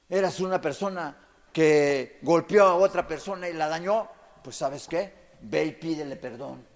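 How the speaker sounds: ordinary delivery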